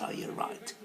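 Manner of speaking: sneering whisper. Real, not acted. Taunting online critics in a sneering whisper. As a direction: hiss it under your breath.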